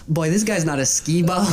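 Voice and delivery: quoted jab, half laughing; mocking through laughter. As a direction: laugh the line out, teasing. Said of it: "and I'm like".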